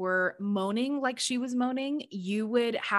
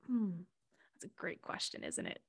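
breathy, much softer whisper; whispered musing; 11 dB quieter than usual. Retold or imitated. Answering Mama Gaia, whispered and wry. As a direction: muse it under your breath.

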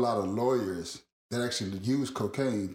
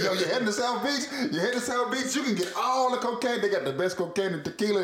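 higher, pushy salesman voice for the driver; hyped-up Colombian driver; 9 semitones higher than usual. Retold or imitated. Driver pitching cocaine to his passengers, lightly voiced hype. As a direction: sell it like a grinning cab driver.

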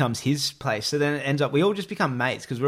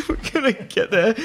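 laughing; 8 semitones higher than usual. Real, not acted. Laughing while telling dance story.

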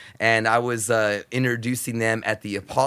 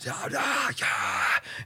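crowd hype yell; 3 semitones lower, 6 dB quieter, much slower than usual. Retold or imitated. Hyping the crowd on stage.

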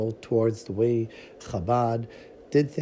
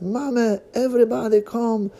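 high, loud joyful call to family; excited calling; 10 semitones higher, 4 dB louder than usual. Acted. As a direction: shout it out with joy. Father joyfully calls family.

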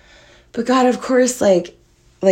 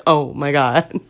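lower, subdued mock dismay; understated deadpan reaction; 4 semitones lower, slower than usual. Woman voicing her dog after the near drop, understated. Voices the dog just sitting there.

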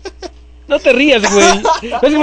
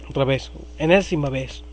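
usual speaking voice

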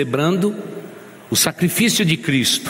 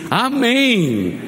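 put-on joyful child's amen; 10 semitones higher than usual. Little girl jumps up at the table, joyful high Amém. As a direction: leap up with a child's glad Amém. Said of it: "aquela menininha pulou e disse assim".

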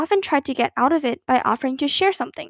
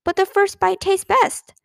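put-on higher, childlike protest; reasonable exclamation; 7 semitones higher than usual. A narrator's reading. Little sister defending her apple bites, exclaiming reasonably. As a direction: argue it as perfectly obvious. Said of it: "exclaimed Ramona reasonably".

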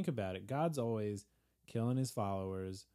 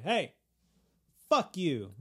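louder, higher snap; mock angry Peter; 6 semitones higher, 6 dB louder, slower than usual. Peter snubbing the devil, mock angry. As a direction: spit it back at him. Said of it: "then Peter's like".

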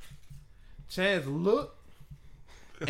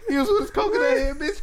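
1 laughing; 12 semitones higher than usual. Real, not acted. Teasing through laughter.

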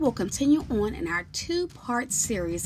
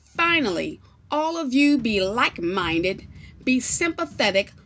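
scripture reading; 3 semitones higher, 7 dB louder than usual. Acted. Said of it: "begins to read the following scriptures".

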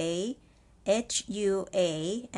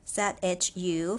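usual speaking voice

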